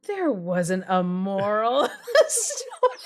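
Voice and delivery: slow, laughing through it; laughing reply. Retold or imitated. Telling blog readers there's no moral, laughing slowly. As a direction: answer readers while chuckling. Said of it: "I'm like".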